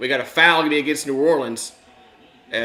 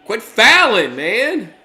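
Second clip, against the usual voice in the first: frustrated yell; 9 semitones higher, 10 dB louder, slower than usual. Real, not acted. Fan yelling at team fouling.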